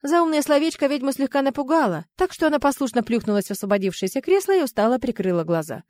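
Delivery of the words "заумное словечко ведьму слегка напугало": usual speaking voice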